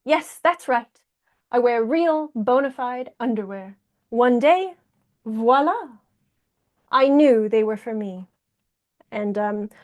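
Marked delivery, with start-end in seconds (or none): 0.1-8.2 theatrical storytime reading in character; measured picture-book performance with lingering pauses; Jacques the proud hedgehog. Acted. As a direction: share the secret proudly, phrase by phrase.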